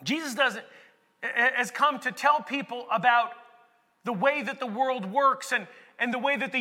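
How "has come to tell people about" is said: normal talking voice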